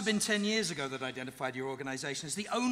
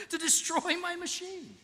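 gloating flourish; 5 dB louder than usual. Acted. Villain's gloating climax.

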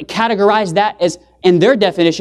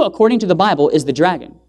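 ordinary talk

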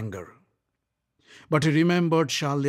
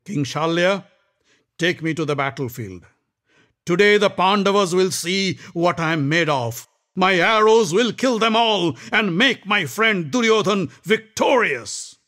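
higher, louder boast; boastful Karna; 7 semitones higher, 7 dB louder than usual. Acted. Karna boasting to Shalya, loud and proud. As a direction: swell with pride.

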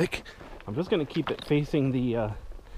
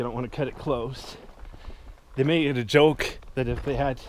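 ordinary talk, nothing marked

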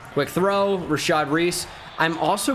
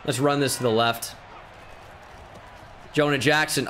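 normal talking voice

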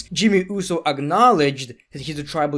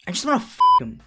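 sudden high-pitched blurt; mock lustful outburst; 11 semitones higher, 3 dB louder, much faster than usual. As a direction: blurt out the mock crush, voice leaping up. Mock lovesick swoon bursts out.

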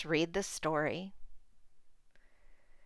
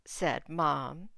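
put-on clear, expressive read-aloud for small kids; reading to children. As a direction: read the picture book to the class. Teacher reads picture book aloud.

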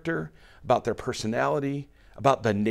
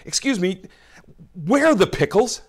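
higher-pitched, impatient question to a store clerk; exasperated; 7 semitones higher, 5 dB louder than usual. Pretend. Shopper asking a grocery clerk, exasperated and higher. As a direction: sound fed up after searching every aisle. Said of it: "you're a little exasperated".

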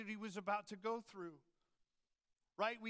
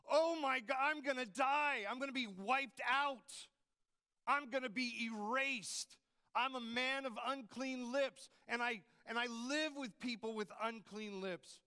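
loud, higher, frantic cries of doom; panicked terror; 4 semitones higher, 5 dB louder than usual. Retold or imitated. Isaiah in God's presence, panicking loudly about dying. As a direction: blurt it out like you're about to be wiped out. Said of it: "He's like".

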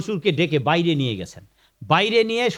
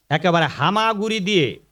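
normal talking voice

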